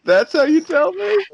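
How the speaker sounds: very high, loud, slow protest; mock-outraged patient. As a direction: squeal in hammed-up disbelief at the doctor. Patient protests doctor's blunt news.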